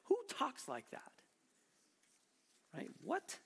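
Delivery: high-pitched baffled question; mock bafflement. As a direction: act puzzled, voice lifting high. Acts baffled at puzzling words.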